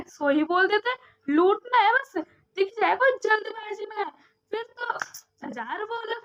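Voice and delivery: loud, high, exasperated scolding; fed-up tirade about the fare; heated rant. Retold or imitated. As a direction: tell the greedy driver off hard. Heated rant at overcharging driver.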